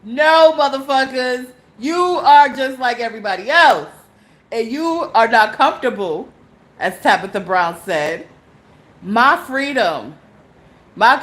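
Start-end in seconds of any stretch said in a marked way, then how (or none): 0.0-6.3 louder, forceful retort; defiant. Real, not acted. Firing back at people who judge her. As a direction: throw it back at them.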